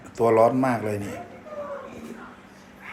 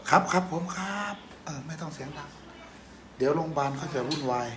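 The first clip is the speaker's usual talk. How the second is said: hushed voice; 11 dB quieter than usual. Real, not acted. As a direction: keep it down, soft and subdued. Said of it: "อย่าเสียงดังสิ".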